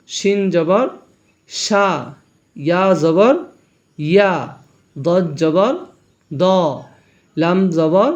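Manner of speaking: sing-song spelling chant, rising on each letter name; recitation drill. Shown as a demonstration. Teacher drilling learners in jabar letter sounds, measured rising chant. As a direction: lead the class letter by letter, pausing between items.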